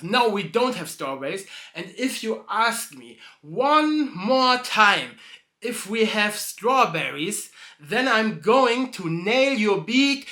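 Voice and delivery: loud, slow, menacing threat; slow it down and threaten; angry threat. Acted. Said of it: "so he says that".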